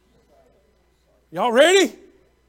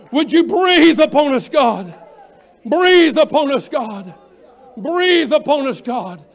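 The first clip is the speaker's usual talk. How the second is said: full-force preaching; 3 semitones higher, 6 dB louder than usual. Real, not acted. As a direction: drive the congregation to their feet. Preacher leads rousing altar call.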